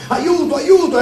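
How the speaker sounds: high-pitched mock cry for help; frightened cry. A narrator's reading. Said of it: "aveva paura e cominciò a gridare". (grido d'aiuto impaurito, acuto)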